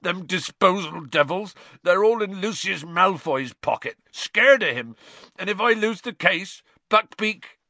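high, loud tearful rant; distraught and tearful; still sobbing. Acted. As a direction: keep the sob going through the complaint. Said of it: "sobbed Hagrid".

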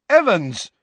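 loud, high call; shouted. A narrator's reading. James calls after Lily as she leaves, shouting. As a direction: shout after someone leaving. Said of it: "James shouted after her".

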